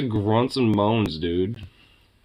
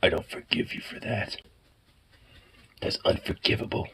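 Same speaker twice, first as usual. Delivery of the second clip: soft whispered mock pet grudge; voicing the offended pet; 5 semitones lower, 6 dB quieter than usual. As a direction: give the pet a sulky hushed voice. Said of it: "he's like".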